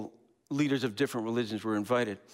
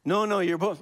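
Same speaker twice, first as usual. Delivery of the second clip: louder, higher pushy correction; mock insistence; 7 semitones higher, 5 dB louder than usual. The merger insisting to B, loud and high. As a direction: insist they are both, pressing hard. Acted quarrel voices.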